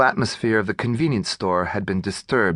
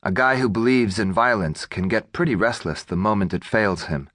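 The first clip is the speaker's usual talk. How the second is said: ordinary delivery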